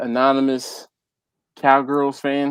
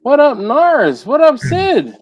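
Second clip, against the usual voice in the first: calling out; 11 semitones higher, 7 dB louder than usual. Real, not acted. Calling out greetings to viewers.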